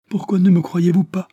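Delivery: high, loud pained outcry; irritated reproach. A narrator's reading. Lady Adélaïde cries out at the officer, hurt and irritated, high and loud. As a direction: fling the reproach back at him. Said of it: "s'écria-t-elle d'un ton de reproche irrité".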